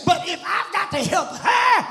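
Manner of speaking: driving sermon cadence, climbing into high, strained cries; fiery church preaching, building in pitch; impassioned preaching. Real, not acted. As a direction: preach with mounting fervour, dropping for asides. Preaches at full force.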